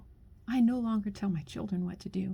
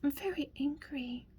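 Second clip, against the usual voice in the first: hushed whisper; 4 semitones higher, 4 dB quieter than usual. Real, not acted. Confessing her anger again to a man, in a hushed whisper. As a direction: repeat it in a soft, falling whisper.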